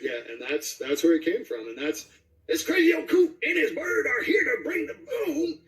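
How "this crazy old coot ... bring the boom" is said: put-on much higher crazy old coot; high manic old coot bragging; Dynamite voice. Shown as a demonstration. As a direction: crank it up into a wild old-timer. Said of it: "that was Dynamite".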